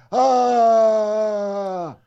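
long high wailing fake sob; drawn-out hammed-up crying howl; bigger mock sob. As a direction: stretch the pretend bawl out long. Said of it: "Bigger sob".

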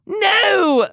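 very high, loud moaned refusal. A narrator's reading. Tamlin begs the lover to continue, moaning. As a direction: wail it out, pleading. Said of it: "moaned Tamlin".

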